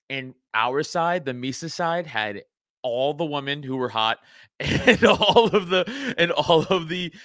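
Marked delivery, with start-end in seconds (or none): 4.4-7.1 slow, laughing through the words. Real, not acted. Podcaster retelling a convention speech, laughing in disbelief. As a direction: break into laughter while retelling.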